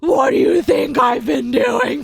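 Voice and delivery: slow, loud, seething shout; wife yelling at husband in labor; furious yell. Retold or imitated. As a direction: let him have it. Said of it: "I was so mad".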